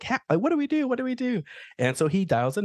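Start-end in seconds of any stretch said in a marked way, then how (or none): 0.0-1.4 panicked. Retold or imitated. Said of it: "She's like".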